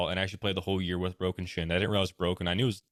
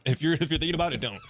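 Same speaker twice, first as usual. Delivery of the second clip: laughing; 6 semitones higher, faster than usual. Real, not acted. Laughing advice on first bat.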